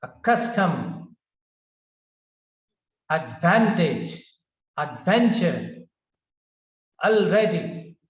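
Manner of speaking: adVANtage, adVENture, alREADy: second syllable punched, then falling; stress on second syllable. Shown as a demonstration. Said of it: "The stress is on the second syllable".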